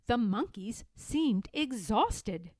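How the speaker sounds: ordinary delivery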